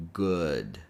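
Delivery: "good" with a short, rounded oo vowel; vowel demo. Shown as a demonstration. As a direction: show the vowel in "good", low and falling. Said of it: "the same vowel sound in good".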